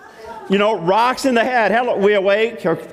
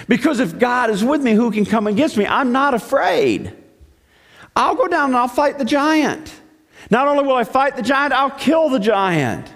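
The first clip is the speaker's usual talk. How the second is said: higher-pitched, emphatic resolve; acted bold defiance; 7 semitones higher than usual. Shepherd boy volunteering before Saul, acted bold resolve. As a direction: declare it boldly, building conviction. David insists he will fight.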